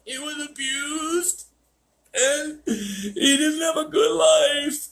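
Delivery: very high, slow mock weepy voice; high slow falsetto of a weeping owner; crying owner voice. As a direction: whine it like a sobbing dog owner. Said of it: "He's like".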